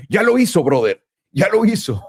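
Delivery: high, eager mock cheer; excited crowd. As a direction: imitate hyped traders. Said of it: "ahorita la gente está".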